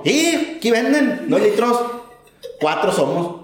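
high, sing-song Oaxacan; a high Oaxacan lilt; Oaxacan accent. Retold or imitated. Worker telling the trucker there are four of them, high Oaxacan accent. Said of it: "Ay, los oaxaqueños".

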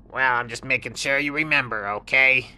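high, sly little scorpion; high little scorpion voice, offering help. Acted. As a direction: squeak it out like a small sly critter.

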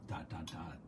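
dot, dot, dot, low and hushed, like a breath; soft meditative dots. Shown as a demonstration. Host leading listeners through the dot meditation, hushed and low. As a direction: let each dot fall away quietly. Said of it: "and say".

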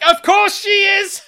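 high, full-voiced cheering yell; mock triumphant shout. [name] hails mum's big line, high triumphant shout. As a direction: roar it like a crowd going wild.

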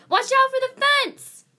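very high, loud, drawn-out yell; shouted warning. Acted. Luna warns Shadow of the fence ahead, shouted. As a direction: scream out an urgent warning.

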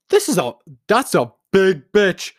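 loud, high, stunned exclamation; shocked gasp. Real, not acted. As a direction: gasp and blurt out in disbelief. Shocked at search result.

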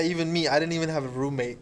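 ordinary delivery

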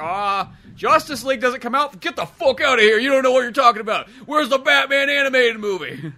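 loud, high indignant tirade; mock rant. Fan ranting at clerk, loud mock indignation. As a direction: scoff and berate the clerk.

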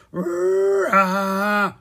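loud, high imitated snore, drawn out; sawing snore. A narrator's reading. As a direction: saw out one huge rasping snore. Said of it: "The sawing sound grew louder".

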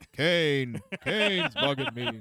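slow, loud put-on character voice; movie-quote voice. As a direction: drag the words out as a hammed-up film quote. Film line quoted in character, laughter after.

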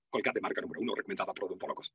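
low, soft commercial read; deep, quiet announcer voice; natural voice-over. Shown as a demonstration. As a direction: deliver a toothpaste tag, low and easy. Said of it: "lo de Colgate es natural".

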